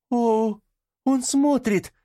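very high, astonished cry; joyful exclamation. A narrator's reading. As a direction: exclaim in sudden amazed relief. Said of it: "восклицает".

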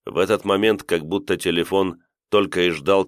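ordinary delivery

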